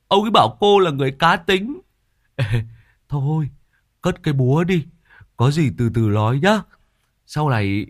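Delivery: high, coaxing sweet talk; butter her up nervously; flattering old man. Acted. Tứ flattering Quýt to calm her, high and coaxing.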